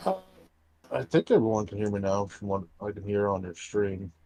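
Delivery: muffled fishbowl audio; like talking inside a fishbowl. Real, not acted. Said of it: "Sounds like you're in a fishbowl".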